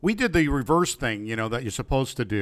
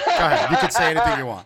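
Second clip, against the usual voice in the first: laughing tease; 4 semitones higher, 3 dB louder, faster than usual. Real, not acted. Teasing partner, laughing.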